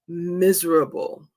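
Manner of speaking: normal talking voice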